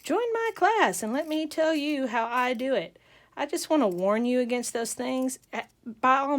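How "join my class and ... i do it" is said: higher, mock sales pitch; lightly put-on promo voice; online class promoter. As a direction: imitate a social media tutor selling a class. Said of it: "videos on my Facebook feed that says".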